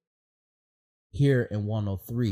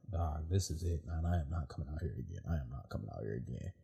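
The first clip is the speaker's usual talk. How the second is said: low, much softer and quicker, spent; worn-out muttering; 5 semitones lower, 11 dB quieter than usual. Retold or imitated. To himself in the circle drills, a low exhausted mutter. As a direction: mutter it like you're gassed and done. Retelling exhaustion during McMath class.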